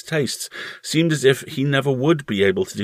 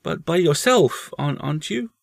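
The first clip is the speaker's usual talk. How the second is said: put-on hesitant boy, raised pitch; young boy; 9 semitones higher than usual. A narrator's reading. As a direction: stumble on it as a small boy. Boy presses his mother.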